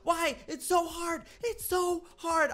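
high, loud wail of despair; anguished wailing. Real, not acted. Losing the build round, wailing at the screen. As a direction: wail that it's all gone wrong.

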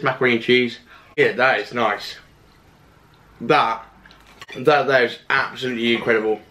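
talking between bites; muffled by food; mouth full, chewing. Real, not acted. Seen 1: Bites at -1.0 and again at 3.1; jaw works between phrases.

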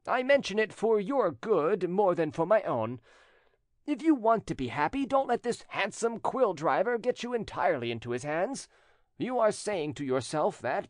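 high-pitched, earnest warning; insinuating rejected suitor. A narrator's reading. Jilted cousin warning the widow about her lover, insinuating. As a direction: warn her urgently against the rival.

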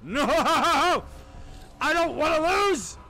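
loud, high cry of protest; desperate shout. Real, not acted. Player panicking at losing, desperate high shout. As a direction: yell at the game in real panic.

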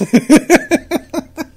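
loud, high real laugh bursting out; burst of laughter. Real, not acted. Laughs at the quote.

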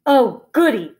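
greedy troll exclaiming with glee; gleeful troll. A narrator's reading. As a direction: relish the coming meal, loudly. Delighted hungry troll.

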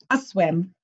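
usual speaking voice

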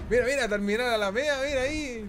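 loud, high-pitched excited exclamation; excited recognition. Real, not acted. Streamer spotting the Alameda in the game, excited. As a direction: point it out loudly, thrilled. Spots a known avenue in game.

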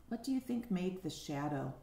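ordinary talk

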